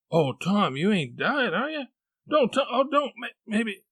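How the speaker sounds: put-on high, distressed boy; panicky, rising pitch, stumbling over words; frightened pleading. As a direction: plead desperately with a dying brother. Sid fears Tom is dying.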